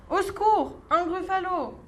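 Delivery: high-pitched mock panic; mock frightened cry. Mouse seeing the real gruffalo, high startled cry. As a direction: squeal like a startled little mouse.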